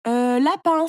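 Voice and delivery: blurted storybook exclamation; crying out. A narrator's reading. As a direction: call the answer out. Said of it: "s'écria Léo".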